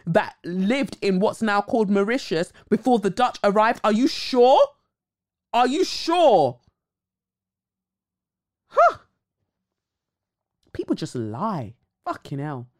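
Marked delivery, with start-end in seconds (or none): 3.8-6.6 incredulous. Real, not acted. Mock disbelief at narrator. 8.7-9.0 sharp high disbelieving scoff; high scoffing huh. Real, not acted. Scoffing at the narrator's lie.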